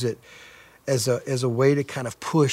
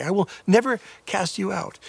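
higher, fervent preacher's proclamation; emphatic promise; 6 semitones higher than usual. Acted. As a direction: declare it with conviction to the doubter. Jesus quoted after lying accusation.

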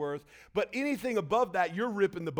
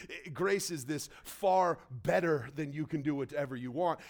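ordinary talk, nothing marked

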